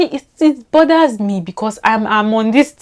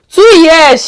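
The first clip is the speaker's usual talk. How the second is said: much higher and louder, stunned; incredulous exclaim; 9 semitones higher, 12 dB louder than usual. Real, not acted. Vlogger marvelling to viewers that she changed her accent, incredulous exclaim. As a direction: blurt it out in disbelief.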